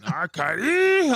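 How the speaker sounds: very high, loud, drawn-out squeal; mocking high voice. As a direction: stretch the name out way up high. Mocking wrestler promo voice.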